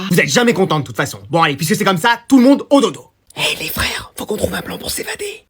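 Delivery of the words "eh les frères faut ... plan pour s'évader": hushed, secretive whisper; conspiratorial whisper. Acted. Child plotting an escape with brothers, whispering. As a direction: whisper so the parents won't hear.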